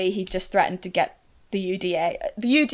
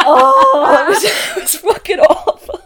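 loud, high, helpless with laughter; laughing; 10 semitones higher, 12 dB louder, slower than usual. Real, not acted. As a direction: groan it out through real laughter. Cracking up at own story.

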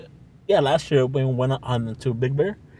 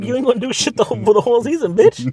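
heated rant; 10 semitones higher, 6 dB louder than usual. Real, not acted. Ranting at zodiac girl.

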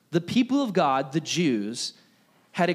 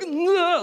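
disgusted ugh; 14 semitones higher, 3 dB louder than usual. Retold or imitated. Disgusted reaction to snake.

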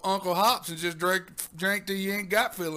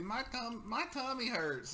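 put-on whiny kid voice; 5 semitones higher, 8 dB quieter, slower than usual. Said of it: "Buddy went home yesterday".

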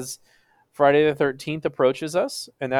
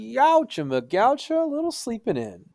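high playful exclaim; 10 semitones higher than usual. Acted. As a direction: squeal it teasingly, high-pitched.

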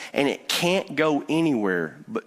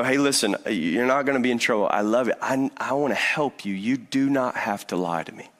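gentle reassurance; 4 semitones lower than usual. Retold or imitated. Dad gently coaxing lying son.

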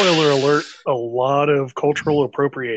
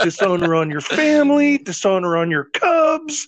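mock dramatic rant; 6 semitones higher than usual. Podcast host quoting a cartoon curse at his cohost, hammed-up shout. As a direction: ham up the cartoon dragon's outrage.

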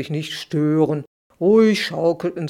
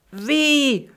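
yelling; 8 semitones higher than usual. A narrator's reading. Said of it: "schrie Klacks".

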